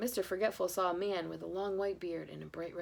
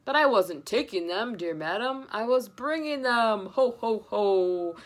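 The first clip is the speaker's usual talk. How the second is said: loud, slow jolly protest; Santa; 2 semitones higher, 8 dB louder, slower than usual. Acted. Santa protesting to the wife, jolly. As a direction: reassure with a big laugh.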